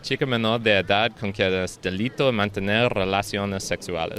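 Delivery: Spanish in an off, English-sounding accent; odd-accented Spanish. Real, not acted. Taking own turn at the Spanish phrase, foreign-accented Spanish. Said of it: "what accent you have in Spanish".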